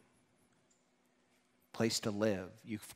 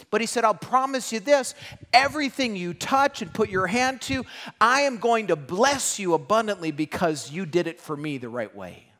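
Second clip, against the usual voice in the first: high, loud preaching of God's promise; fervent preaching; 8 semitones higher, 8 dB louder than usual. Acted. Preacher proclaiming God's promise to the congregation. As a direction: proclaim it with full force.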